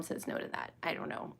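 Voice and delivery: breathy whisper, almost to herself; whispered aside. Real, not acted. To herself in a whisper while stalling, soft whisper. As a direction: drop to a whisper under her breath.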